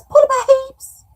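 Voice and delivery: high, loud, slow squeaky put-on voice; hammed-up silly voice; mock voice. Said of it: "And then Dee Dee goes".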